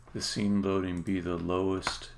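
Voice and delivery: normal talking voice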